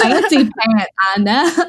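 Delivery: light Southern twang, playful; Tennessee accent. Acted. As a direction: drawl it out in a mild Tennessee accent. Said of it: "Why am I doing my Tennessee voice?".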